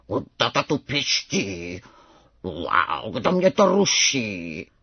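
low, slow growly tiger cub roar; roaring like tiger father. A narrator's reading. Little tiger woken by a squeak, roaring like his father. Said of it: "zařval divné jako tatínek".

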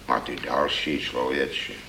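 ordinary delivery

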